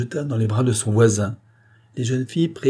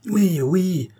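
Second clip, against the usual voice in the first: higher-pitched eager cry; cri de foule; 9 semitones higher, 3 dB louder than usual. A narrator's reading. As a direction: cry out as a whole crowd. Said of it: "crièrent toutes les voix". (cri aigu de la foule)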